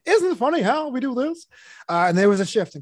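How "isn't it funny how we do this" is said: higher, setup-style standup delivery; observational comic. Retold or imitated. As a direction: do it like a Seinfeld bit. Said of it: "were observational".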